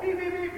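high imitated alarm beeping; phone alarm beeps. Preacher imitating church cell phones blaring an alert. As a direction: mimic the phone siren going off, high and sharp.

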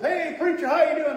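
bright, high, hearty hello to the preacher; friendly greeting. Retold or imitated. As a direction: boom out a cheerful small-town greeting.

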